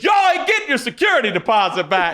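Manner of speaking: high, fast mock scolding shout; bus driver scolding. Driver scolds the rioters as they rock the bus, high and fast. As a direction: fire it off like the fed-up driver. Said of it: "She goes".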